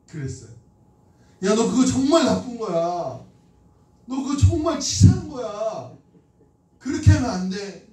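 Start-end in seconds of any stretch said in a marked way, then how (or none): 1.4-7.9 higher-pitched, insistent telling-off; retold scolding. Preacher replays lecturing a child about swapping money, scolding. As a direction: replay a parent's scolding, driving each point home. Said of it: "막 야단쳤어요".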